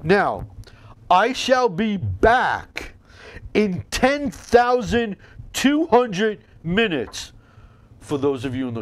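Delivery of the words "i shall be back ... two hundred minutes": high, very slow grand announcement; dramatic slow proclamation. Acted. Mock grand sign-off.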